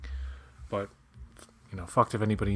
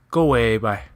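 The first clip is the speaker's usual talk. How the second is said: Newfoundland farewell; 6 dB louder than usual. Retold or imitated. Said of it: "They say, they say".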